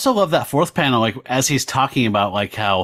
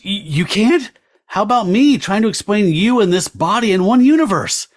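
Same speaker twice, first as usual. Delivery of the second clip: higher, rattled disbelief; flustered Byron; 7 semitones higher than usual. Acted. Student priest Byron rattled by Damon, pitched-up disbelief. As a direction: priest losing his cool, pitched up.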